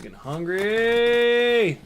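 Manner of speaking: last word stretched high and loud; playful whine. Pretend. Host wanting to go get food, playful drawn-out whine. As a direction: let it climb into a long wail.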